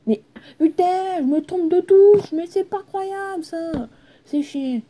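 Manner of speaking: high, slow exasperated rant; frustrated outburst. Real, not acted. Gamer hitting the wrong key, high and exasperated. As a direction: whine in pure frustration. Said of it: "il faut que je me calme".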